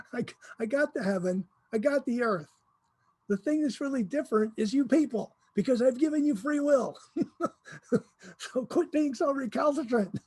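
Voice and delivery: voicing God with a grin, laughing as he goes; laughing paraphrase. Preacher paraphrasing God to his listeners, laughing. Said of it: "So he's saying".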